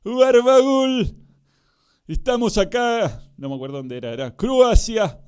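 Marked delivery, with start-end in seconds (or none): 0.0-3.3 high, loud, stretched-out commentator calls; hammed-up beach soccer commentary; sports commentator shout. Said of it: "Yo comenté Mundial de Fútbol Playa". 4.4-5.2 high, loud, stretched-out commentator calls; hammed-up beach soccer commentary; sports commentator shout. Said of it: "Yo comenté Mundial de Fútbol Playa".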